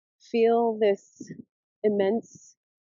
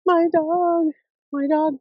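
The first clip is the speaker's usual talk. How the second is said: amazed; 8 semitones higher, 6 dB louder, slower than usual. Retold or imitated. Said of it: "And I was like".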